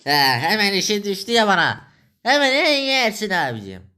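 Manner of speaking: high, mocking sing-song of a fawning request; sarcastic mockery. Mocking a friend who only visits when he needs money, high and sarcastic.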